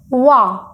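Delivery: वा with firm pressure; stressed syllable. Shown as a demonstration. Teacher showing where pressure goes, वा stressed. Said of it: "जब हम वा बोलते हैं तो हम स्ट्रेस देते हैं".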